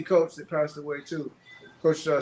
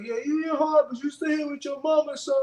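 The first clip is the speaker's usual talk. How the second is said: mocking trash talk; 11 semitones higher than usual. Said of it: "He was like".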